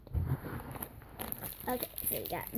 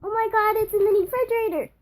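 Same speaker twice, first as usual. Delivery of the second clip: high, loud, fast burst of delight; real excitement bubbling over; thrilled gasp; 8 semitones higher, 8 dB louder, faster than usual. Real, not acted. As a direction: blurt it out, thrilled at the find. Thrilled opening a blind bag.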